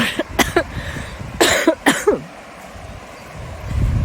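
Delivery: heavy rasping cough from a cold; chesty cough. Real, not acted. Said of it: "worst chest cold".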